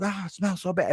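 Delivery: high-pitched mock whine of disgust; suffering crewmates. Crewmates react to the smell, mock wailing disgust. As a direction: wail it high, as if overcome by stink. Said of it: "that's when they're all like".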